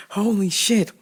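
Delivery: usual speaking voice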